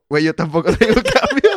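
retelling his panic while laughing. Real, not acted. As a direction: laugh out the punchline. Laughing while retelling own thought.

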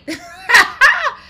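high, loud burst of laughter; scream-laugh at the absurdity; shrieking laugh. Real, not acted. Cracking up at headline.

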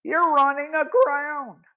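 high, breathless cry of alarm; alarmed gasp. Acted. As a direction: gasp it out as the boat heads for shore. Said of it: "Jerry gasped".

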